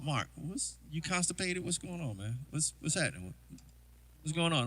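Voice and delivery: imitating a discreet off-mic question; hushed aside to the drummer; real quiet. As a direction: keep it soft, just for the bandmate. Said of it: "like, real quiet, not on, on the mic".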